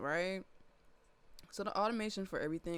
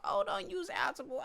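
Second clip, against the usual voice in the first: high, mocking whine; mocking critic voice; 3 dB louder than usual. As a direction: mimic a snobby critic. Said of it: "people be talking about".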